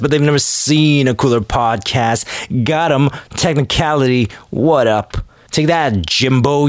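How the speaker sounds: ordinary delivery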